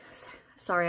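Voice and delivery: whispered through a covered mouth; breathy muffled apology. Real, not acted. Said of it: "I'm trying to talk with my mouth covered".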